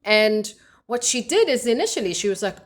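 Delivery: ordinary delivery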